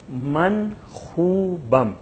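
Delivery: من خوبم read slowly, each syllable clear; slow Persian model. Shown as a demonstration. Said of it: "ہم تلفظ کر رہے ہیں". (من خوبم کو آہستہ اور صاف تلفظ سے)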